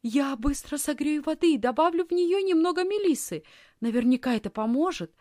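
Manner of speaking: concerned, hurrying to help; worried. A narrator's reading. Girl offering a remedy to her sick aunt, worried. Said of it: "озабоченно сказала Лисхин".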